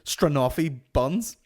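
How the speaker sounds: loud mock-furious demand; hammed-up rage over pastries; angry about buns. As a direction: aggressively order buns, breaking up with laughter. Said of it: "just getting so angry about buns".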